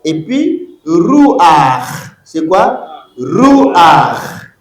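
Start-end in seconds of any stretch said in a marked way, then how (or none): 0.9-2.1 loud "Ruach", a sounded before the guttural ch; furtive patah demo. Shown as a demonstration. Teacher models for the class, loud "Ruach" with a before ch. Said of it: "Vous prononcez d'abord les A". 3.2-4.5 loud "Ruach", a sounded before the guttural ch; furtive patah demo. Shown as a demonstration. Teacher models for the class, loud "Ruach" with a before ch. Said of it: "Vous prononcez d'abord les A".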